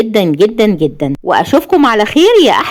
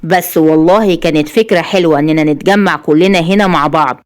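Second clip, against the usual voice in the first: usual speaking voice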